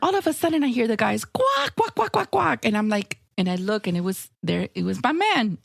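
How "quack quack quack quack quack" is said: high duck quacks, fading down; mock quacking. Guys teasing the women with duck quacks.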